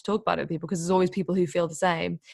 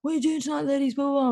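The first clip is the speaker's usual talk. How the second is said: retold heckling scream; 8 semitones higher, faster than usual. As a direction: mimic a street heckler, pitched well up. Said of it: "this guy literally just screamed at us".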